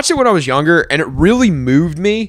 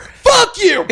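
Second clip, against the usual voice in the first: mock yell. Acted yell out the window.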